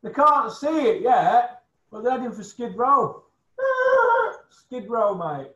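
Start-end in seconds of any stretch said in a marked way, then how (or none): 3.6-4.4 a burst of high, loud laughter at his own joke; loud mocking laugh. Real, not acted. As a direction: cackle out loud, amused. Laughing at his own Skid Row jab.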